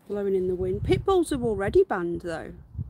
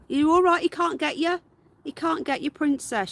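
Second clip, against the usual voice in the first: high, louder soothing pet voice; 7 semitones higher, 4 dB louder than usual. Real, not acted. Owner comforting her frightened rabbit in high baby talk. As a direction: comfort the scared bunny.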